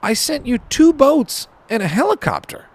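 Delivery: a comic high God voice, matter-of-fact. Acted. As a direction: deliver the punchline as God, deadpan. God answers the complaining man.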